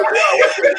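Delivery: high startled what; mock shocked what. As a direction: squeak out a stunned what. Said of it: "I'm like".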